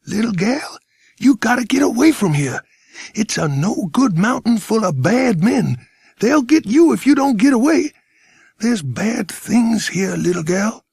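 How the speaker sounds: high, urgent American warning; American accent, pitched well up, insistent. A narrator's reading. Stranger warning little girl away, urgent high American accent. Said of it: "He sounded like an American".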